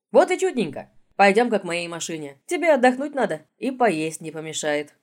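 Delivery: cheerful caretaker chatter. Acted.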